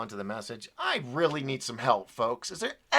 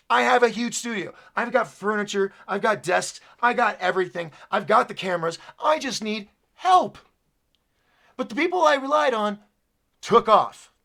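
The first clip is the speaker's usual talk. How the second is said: raised, higher voice, insistent listing; worked-up, aggrieved rant; frustrated venting; 5 semitones higher, 4 dB louder than usual. Real, not acted. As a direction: build to an exasperated high plea. Venting about being left without help.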